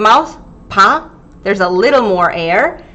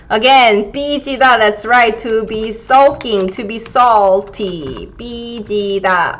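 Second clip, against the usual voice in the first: normal talking voice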